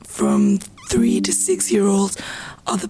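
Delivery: hoarse, half-whispered, strained voice; losing her voice. Real, not acted. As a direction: push words out through a worn-out voice. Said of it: "Sorry for my voice".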